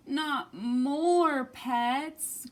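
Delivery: very slow weary groan; drawn-out groan of despair; groaning with a sigh. Acted. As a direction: sigh it out, fed up. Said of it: "groaned Bob with a heavy sigh".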